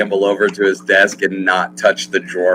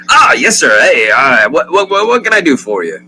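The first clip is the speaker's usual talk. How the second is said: flustered, higher, louder stammer; startled shopkeeper; 5 semitones higher, 4 dB louder than usual. Acted. Shopkeeper startled by a customer's cough, flustered. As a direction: caught off guard, stumbling over words.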